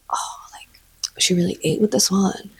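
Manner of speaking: whispered, amazed; hushed, impressed whisper; awed whisper. Retold or imitated. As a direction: breathe it out in quiet awe. Awed reaction to a video.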